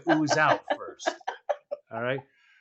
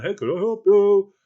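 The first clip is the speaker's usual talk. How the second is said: mock operator; 6 dB louder than usual. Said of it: "the guy comes on".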